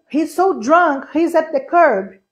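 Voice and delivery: slow English line; slow careful English. Shown as a demonstration. Teacher slowly reading the subtitle line to students. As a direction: read it out slowly. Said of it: "Aí ela ouviu".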